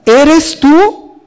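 "tú" alzado, pregunta que sube; stress on TÚ. Shown as a demonstration. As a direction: subraya "tú" con pregunta aguda. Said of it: "Así claramente". (énfasis en "tú", tono alto)

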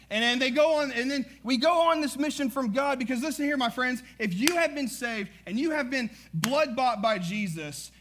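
rising pulpit fervor; full-force preaching. Acted. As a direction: build the congregation up, fired up.

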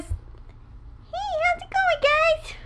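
put-on high squeaky child voice; kid voice. Shown as a demonstration. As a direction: talk like a little kid. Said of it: "I'll do my kid voice".

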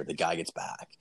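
whispered suspense; whispering. Real, not acted. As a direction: hush it like a secret. Suspense beat in story.

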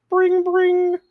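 high ringing noise; phone ringing. Pretend. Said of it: "Midas is pulling his phone out".

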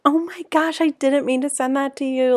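high "oh my gosh" in put-on panic; fake embarrassment. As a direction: play up a staged embarrassed gasp. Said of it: "I was like".

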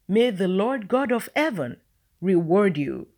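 ordinary delivery

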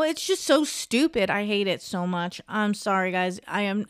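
ordinary delivery

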